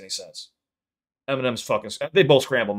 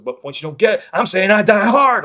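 "I die hard" pushed higher and louder, hammered home; punching the words; 8 semitones higher, 9 dB louder than usual. Retold or imitated. Quoting the rapper's line to show how he punches the words, stressed loud. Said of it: "you're supposed to emphasis".

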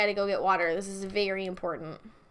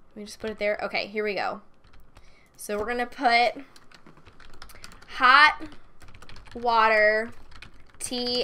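usual speaking voice

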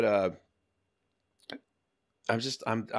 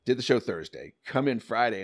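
ordinary talk

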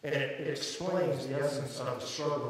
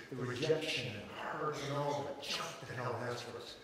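usual speaking voice

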